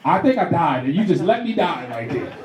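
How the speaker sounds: ordinary talk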